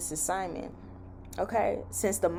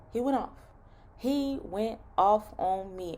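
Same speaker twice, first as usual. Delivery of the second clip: normal talking voice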